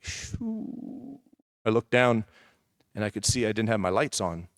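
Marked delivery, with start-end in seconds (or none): 0.0-1.2 soft, high whoosh for a sinking heart; airy imitated whoosh, much softer than speech; whooshing sound. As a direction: blow out a rising "shoo". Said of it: "My heart in that moment was like".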